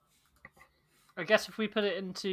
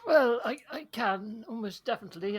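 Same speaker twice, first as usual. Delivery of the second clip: eager; 7 semitones higher, 7 dB louder than usual. Real, not acted. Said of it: "excited reply".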